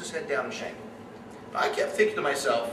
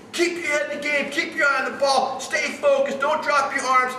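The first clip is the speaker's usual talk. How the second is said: high, loud yelling from the stands; screaming sideline dad; 10 semitones higher, 5 dB louder than usual. Retold or imitated. Sideline dad screaming instructions at his son at bat, high and loud. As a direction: bellow at the kid like a pushy dad. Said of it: "I can hear him screaming".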